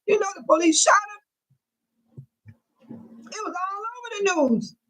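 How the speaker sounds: high, cracking cry of grief as she tells it; sob-shout it, voice breaking in pain. Real, not acted. Grieving aunt telling the room the police shot her nephew, crying out.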